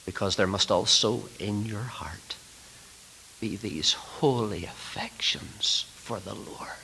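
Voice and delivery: low, soft, half-whispered appeal; hushed reverence. Real, not acted. Preacher appealing to students' hearts, hushed and reverent. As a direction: breathe it out tenderly and solemnly.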